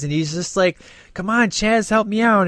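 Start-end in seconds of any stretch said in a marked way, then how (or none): 1.1-2.5 high, mock-urgent appeal; imitated pleading. Fan quoting a movie character asking Chaz for help, mock pleading. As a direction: plead lightly in a raised pitch.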